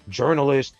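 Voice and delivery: ordinary talk, nothing marked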